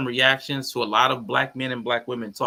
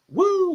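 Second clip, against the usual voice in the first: high, loud "woo"; excited whoop; 6 dB louder than usual. Real, not acted. Streamer greeting a viewer, high excited whoop.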